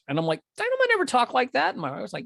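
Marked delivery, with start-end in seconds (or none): 0.6-1.7 very high-pitched, rushed objection; imitating an indignant denial in high voice; retold protest. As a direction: squeak out a quick mock protest. Retold argument with his wife.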